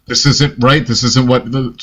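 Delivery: ordinary talk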